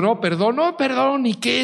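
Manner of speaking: mock scared, rising pleading; hammed-up panicked backing down; frightened apology. Old man scared, begging three men's pardon. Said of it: "ya de plano se asustó y le dijo".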